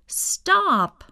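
high, sharp call of stop; crowd shouting stop. Acted. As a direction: call out to halt them. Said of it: "Everyone in the park shouted".

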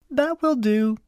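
put-on high-pitched bossy girl; high voice of a child in charge; bossy cousin. A narrator's reading.

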